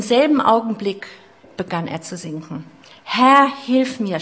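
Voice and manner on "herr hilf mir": higher, louder and very slow cry for help; a sinking man crying out. Acted. Petrus sinking, crying to the Lord, a high, loud, slow cry. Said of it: "schrie er".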